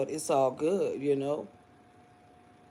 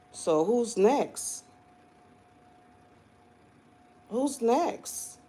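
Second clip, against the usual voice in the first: taunting; 5 semitones higher, much slower than usual. Acted. Taunting haters.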